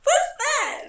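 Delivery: high, loud mimicry of an old coworker; older smoker impression. Older coworker spotting something in the room, mimicked high and loud. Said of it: "That's what she sounded like".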